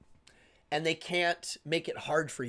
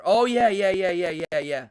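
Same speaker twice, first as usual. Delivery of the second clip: eager agreement; 2 semitones higher, 7 dB louder than usual. Real, not acted. Host agreeing loudly with a comment, then settling. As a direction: pile on the agreement.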